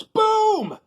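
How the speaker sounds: big high shout of boom; loud, high showman shout; shouted reveal. Real, not acted. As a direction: belt it out like a showman.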